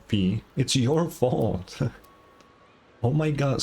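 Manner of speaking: ordinary talk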